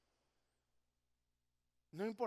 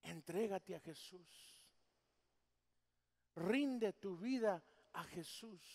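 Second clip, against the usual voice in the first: slow tender appeal; slower than usual. Real, not acted. Altar call, slow.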